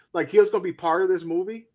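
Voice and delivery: ordinary delivery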